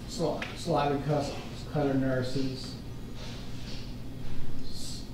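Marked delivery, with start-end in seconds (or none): none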